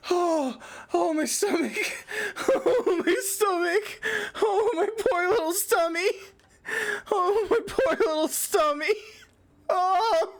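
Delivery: high, wheezing laugh-sobs around the words; aching from laughing so hard; cry laughing. Real, not acted. Streamer to chat, cry laughing till stomach hurts. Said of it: "I'm cry laughing".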